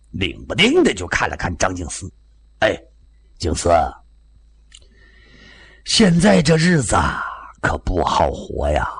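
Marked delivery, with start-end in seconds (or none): 2.6-8.9 tipsy officer. A narrator's reading. Drunk Japanese officer confiding in his host about hard times, mildly tipsy lament. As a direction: grumble like a man who has had a few. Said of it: "这个酒也有点喝多了".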